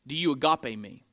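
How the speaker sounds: lightly voiced, low searching question; Jesus. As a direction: ask it low and earnestly, then repeat. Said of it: "The first time he says".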